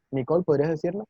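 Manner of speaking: ordinary delivery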